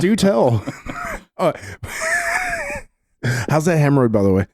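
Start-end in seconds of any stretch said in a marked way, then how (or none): 0.7-1.3 high squeal of a laugh; shrill giggling burst. Real, not acted. Reacts to big news tease. 1.6-2.8 cracking up with a howl; howling laugh. Real, not acted. Keeps laughing at big news.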